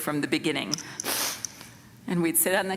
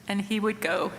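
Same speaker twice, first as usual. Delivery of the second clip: usual speaking voice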